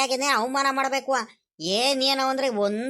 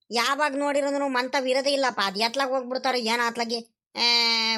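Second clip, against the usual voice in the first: normal talking voice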